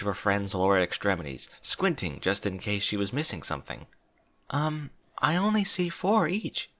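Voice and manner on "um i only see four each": high, slow, timid puzzlement; gently unconvinced; puzzled. A narrator's reading.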